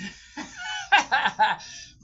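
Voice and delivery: loud high burst of laughter; real laugh, high and loud. Real, not acted. Woman laughing at her own bad-word slip, high laughter.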